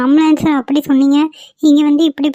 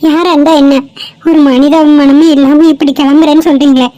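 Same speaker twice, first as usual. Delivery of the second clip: loud, reproachful rebuke; indignant dismissal; 5 dB louder than usual. Acted. Girl telling heartless bystanders to leave, indignant. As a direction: send them away with a raised voice.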